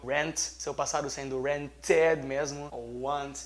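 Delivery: ordinary talk, nothing marked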